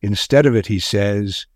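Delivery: plain reading voice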